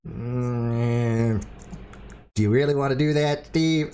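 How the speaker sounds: lightly imitated doubtful co-host, drawn-out start; Cheshire, attempted Canadian accent. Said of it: "I can't do a Cheshire Canadian accent".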